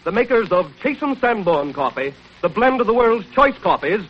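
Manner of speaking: ordinary talk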